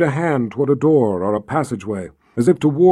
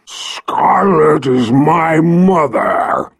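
growling dragon; 4 dB louder, much slower than usual. Acted. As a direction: growl it like a huge beast. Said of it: "the dragon growled".

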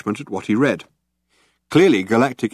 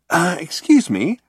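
higher, slow polite interruption; a higher-voiced Ford cutting in politely; 9 semitones higher, 3 dB louder, slower than usual. A narrator's reading.

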